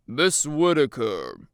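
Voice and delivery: loud, slow stern teacher; scolding teacher. A narrator's reading. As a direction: draw the name out in reproof. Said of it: "the teacher scolds".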